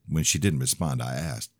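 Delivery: plain reading voice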